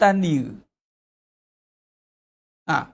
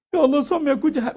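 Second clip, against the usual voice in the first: higher, fast grumble to God; wife griping about her husband to God, higher and fast; mock complaining; 8 semitones higher, faster than usual. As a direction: rattle off a grievance about a husband. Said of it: "janganlah duk ngulang".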